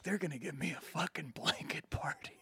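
fast nervous whisper. Retold or imitated. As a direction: hiss it under your breath. Dad's worried whispered aside.

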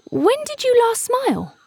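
a bird venturing a question; tentative cuckoo. A narrator's reading. Cuckoo asks.

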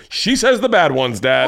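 high, drawn-out mock tattle; tattling sister. Daughter informing dad about sister's swearing, tattling. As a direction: imitate a kid gleefully telling on someone.

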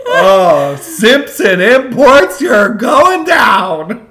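slow, high, loud taunt; mock threat shout. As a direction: holler a drawn-out, gleeful warning at the company. Gleeful threat to the company.

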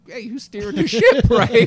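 high, loud laughing retort; loud comeback. Retold or imitated. Comedian fires back at the heckling captain, loud laughing retort.

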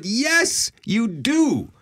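high-pitched, incredulous retort; emphatic insistence. Retold or imitated. Telling Aggie she has a French accent, emphatic insistence. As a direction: insist in disbelief, pitch leaping way up.

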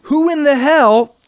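furious and exasperated, building; angry rant. Real, not acted. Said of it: "before I make this angry point".

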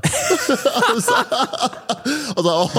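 words squeezed out through high laughter; giggling so hard the voice goes high; laughing. Real, not acted. Laughing while retelling.

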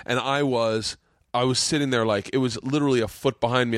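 ordinary talk, nothing marked

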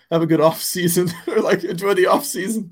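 mock sincere goodbye; sincere well-wish, laughing. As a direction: retell the friendly send-off with a laugh. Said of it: "he meant it sincerely".

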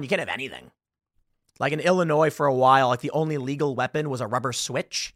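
ordinary talk

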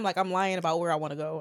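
ordinary talk, nothing marked

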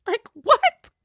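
squeaky high, jaw-dropped what; shocked what. Real, not acted. Vlogger reacting to the Housewives reunion, shocked squeak. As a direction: react like you can't believe it.

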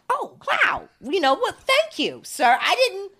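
high, gushing surprised thanks; pleasantly shocked. Retold or imitated. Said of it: "It's always like".

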